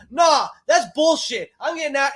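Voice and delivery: high mock huff; mock huffy exit. Mocking the woman walking out, high pitched. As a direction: storm off in a hammed-up high huff. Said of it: "She's like".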